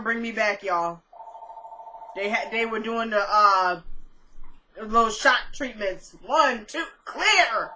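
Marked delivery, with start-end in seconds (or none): 6.2-7.7 playful medic counting off a shock; mock defibrillator call. Host plays medics reviving her in the E R, mock urgent call. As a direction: count it off, then call out the last word higher. Acts out doctors giving shock treatments.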